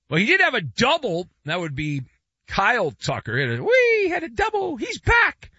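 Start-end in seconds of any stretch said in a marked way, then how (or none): 3.6-5.5 very high-pitched mock cheer; mock celebration. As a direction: squeal with sarcastic glee. Mock celebration of player's double.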